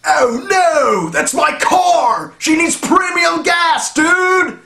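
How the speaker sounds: high, loud shocked yell over his car; panicked dude. Retold or imitated. A dude yelling at his wrecked car, high and loud. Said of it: "voice impressions".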